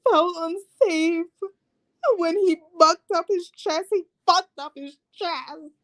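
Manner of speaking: high, whiny-victim Karen impression; mock a Karen claiming she felt threatened; Karen vibe. Reviewer mimicking Lindsay claiming she felt unsafe, mock Karen voice. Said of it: "got in her Karen vibe".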